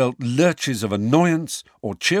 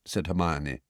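plain reading voice

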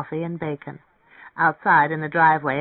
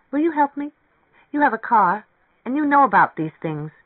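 higher, louder, eager appeal; eager young woman asking for help; pleading woman character; 7 semitones higher, 5 dB louder than usual. Acted. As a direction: coax him with lively insistence. Narrator voices pleading Mary Ann.

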